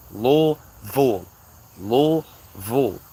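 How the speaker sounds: Louisville drawn out slowly as LOO... VULL; local pronunciation. Shown as a demonstration. Teaching outsiders, Louisville slowly split as LOO-VULL. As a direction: break Louisville into two long syllables, LOO, VULL. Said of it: "If you don't say it like that".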